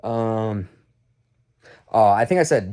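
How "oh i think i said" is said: ordinary delivery, nothing marked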